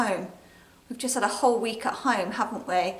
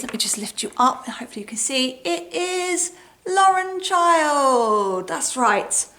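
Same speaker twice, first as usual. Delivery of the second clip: ordinary delivery, nothing marked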